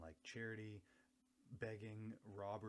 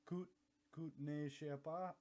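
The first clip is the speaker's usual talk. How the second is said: put-on French accent; 7 semitones higher, 4 dB louder, slower than usual. Mock French phrase retry.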